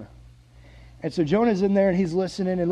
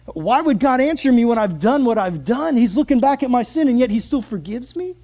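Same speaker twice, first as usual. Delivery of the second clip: high, loud, incredulous questioning; overwhelmed wonder at being forgiven; astonished disbelief; 6 semitones higher, 6 dB louder than usual. Acted. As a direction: ask it in amazed, raised voice. Voicing Jonah's disbelief at forgiveness.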